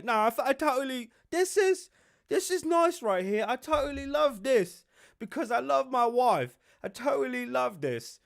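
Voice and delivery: mock a rattled prince protesting he loves it; put-on, strained voice of a prince claiming to be happy; shook Harry. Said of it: "Yo, he was so shook".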